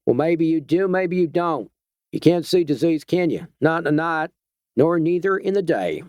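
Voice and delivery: a lightly voiced Georgia backwoodsman toying with the stranger; Georgia twang. A narrator's reading. Vigilante warns the doctor off in a Georgia twang. Georgia man holds his voice.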